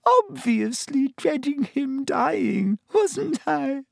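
high, teary schoolgirl voice; tearful. A narrator's reading. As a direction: talk through streaming tears. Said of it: "with streaming eyes".

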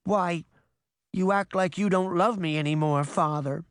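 put-on high, small, hurt child's voice; whimpering. A narrator's reading. Pinocchio doubts his father's love, whimpering. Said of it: "Pinocchio whimpered".

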